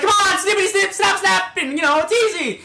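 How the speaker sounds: high, loud, giddy wheedling; coaxing silly voice. Retold or imitated. Timmy coaxes the doctor in a high, giddy, silly voice. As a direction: sweet-talk the doctor in a high goofy voice.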